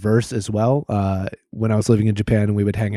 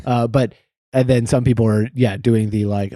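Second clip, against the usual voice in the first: ordinary talk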